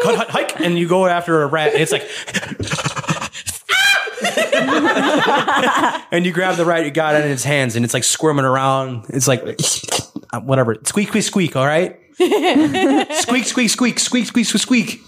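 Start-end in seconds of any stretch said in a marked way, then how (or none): 0.0-0.6 football call. Retold or imitated. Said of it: "you're like". 2.1-6.1 high shrieking laugh. Real, not acted. Big laugh shout. 10.8-11.6 rat squeaks. Acted. 12.2-15.0 high squeaky rat noises; rubbery high squeaky toy. Acted.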